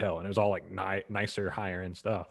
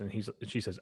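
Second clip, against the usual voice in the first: usual speaking voice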